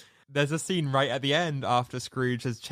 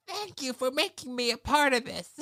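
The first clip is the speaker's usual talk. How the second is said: Rizzo impression; 10 semitones higher than usual. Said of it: "Rizzo just says".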